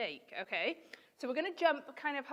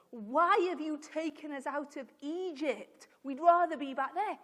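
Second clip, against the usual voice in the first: raised, louder complaining; mildly indignant mock protest, higher and louder; acted crossness; 4 semitones higher, 8 dB louder than usual. Teacher voicing people cross at God and Moses, mock indignation. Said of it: "started getting cross at God".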